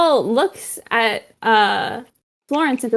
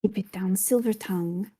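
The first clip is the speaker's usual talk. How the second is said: low, much softer reproof; hushed warning; 3 semitones lower, 10 dB quieter than usual. Acted. Pedro shushes Silver Tongue about his secret, hushed. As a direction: hush him under your voice. Hushing talk of secret paternity.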